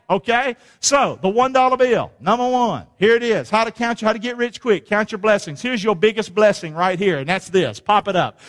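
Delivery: ordinary delivery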